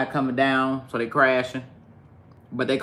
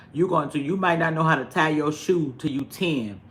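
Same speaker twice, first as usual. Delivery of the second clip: ordinary talk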